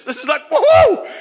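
a very high, loud woohoo; whooping cheer. Retold or imitated. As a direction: cheer it out wildly. Celebrating God's calling.

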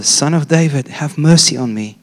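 ordinary delivery

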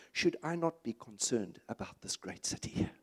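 whispered, low question from God; hushed whisper. Retold or imitated. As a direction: breathe the line out as God's quiet question. God's gentle question to Jonah.

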